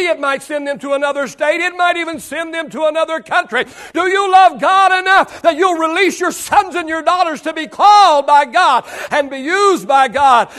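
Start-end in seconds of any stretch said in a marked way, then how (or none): 1.6-10.5 loud, higher preaching shout; preaching at full force. Acted. Preacher calling parents to release children, full-force shout. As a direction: drive it home like a revival call.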